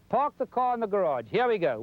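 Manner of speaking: ordinary talk, nothing marked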